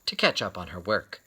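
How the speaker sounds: gentle picture-book reading; storyteller reading to children; story narration. A narrator's reading. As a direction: read it like story time for kids.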